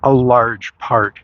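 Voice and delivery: "large" drawn out slowly and leaned on; slow down and weight the word "large"; stress on "large". Real, not acted. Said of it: "emphasis on large".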